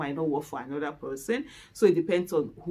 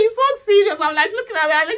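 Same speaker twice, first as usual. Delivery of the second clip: ordinary talk, nothing marked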